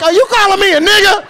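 high, loud comic indignation; mock outrage. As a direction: squawk the accusation, hammed-up offence. Acted affronted reply to dealer.

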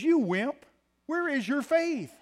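high, loud and slow scolding rebuke; tough love. Pretend. Preacher voicing Jesus rebuking a scared disciple, loud slow scolding. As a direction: scold a frightened disciple slowly. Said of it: "uses a little tough love".

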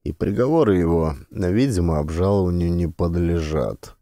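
usual speaking voice

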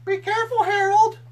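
high loud alarmed scream; screaming mom. Acted. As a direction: shriek it as a panicked mom. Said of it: "I heard my mom scream".

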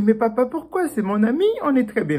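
put-on high, small child voice pleading with papa; a little one begging why; pleading little mouse.

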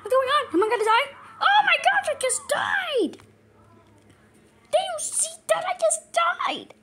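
high, loud shrieks of disbelief; hysterical panic. Pretend. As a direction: squeal in hammed-up shock. Reacting to dying in game.

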